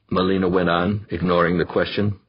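ordinary delivery

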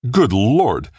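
startled gasp, loud and high. Acted. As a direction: gasp it out in shock. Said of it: "gasped Kossoff".

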